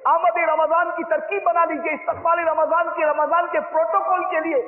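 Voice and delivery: high, loud, fervent sermon voice; full-force preaching. Acted. Preacher urging listeners to prepare for Ramadan, loud and high. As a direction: rouse the crowd for Ramadan.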